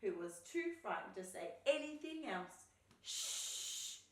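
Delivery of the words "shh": long breathy "shh"; drawn-out storybook shushing; urgent shush. A narrator's reading. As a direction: hush everyone firmly. Fox hushes the frightened friends.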